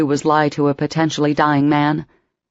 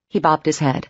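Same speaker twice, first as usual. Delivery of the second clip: plain reading voice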